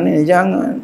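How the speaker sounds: normal talking voice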